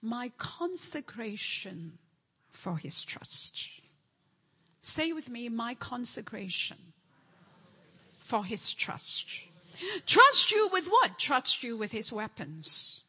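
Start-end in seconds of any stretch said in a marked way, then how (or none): 2.5-3.7 hushed whisper. Real, not acted. Prayerful declaration, whispered. 10.1-11.2 loud, high rhetorical question; loud exclamation. Real, not acted.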